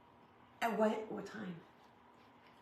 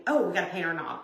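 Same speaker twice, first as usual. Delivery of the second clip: usual speaking voice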